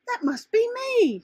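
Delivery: high, slow little butterfly voice; a small wondering creature, lifted high. A narrator's reading. Butterfly's own voice in story.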